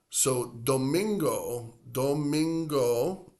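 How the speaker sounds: domingo drawn out slowly, do-MIN-go. Shown as a demonstration. Teacher modeling domingo slowly, rising. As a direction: stretch domingo into three syllables. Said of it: "another three-syllable word".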